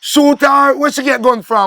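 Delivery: high, loud disbelief; shocked outburst. Acted. Reacting to news of a shooting, shocked. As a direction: shout the question in shock.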